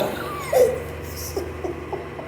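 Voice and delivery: airy giggle rising high; real wheezy giggle; breathy high laugh. Real, not acted. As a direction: let a breathless laugh escape. Laughing at own story.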